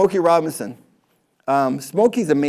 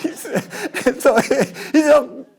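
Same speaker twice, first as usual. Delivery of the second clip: high laughing while telling; 12 semitones higher, 4 dB louder, much slower than usual. Real, not acted. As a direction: laugh through the words. Laughs at the funny moment.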